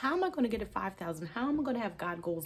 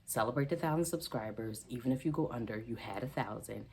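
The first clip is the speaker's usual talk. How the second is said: calm yourself down gently; calm inner reassurance; 5 semitones lower than usual. Retold or imitated. The Lord calming her down, low and soothing. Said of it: "the Lord was like".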